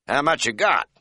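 a brash country cab driver barking down the phone; feisty bark. A narrator's reading. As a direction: bark the question like a sly old driver. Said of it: "barked a feisty voice".

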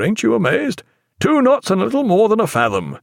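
high, excited captain's exclamation; delighted boasting. A narrator's reading. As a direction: marvel proudly at the figure to your friend. Delighted captain boasts to his friend.

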